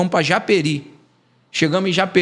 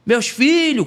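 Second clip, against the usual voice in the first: retold shout; 7 semitones higher, much slower than usual. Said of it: "o pastor gritou".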